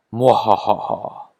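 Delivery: slow hammy villain laugh; sneering panto villain cackle. Pretend. Witch laugh after the threat.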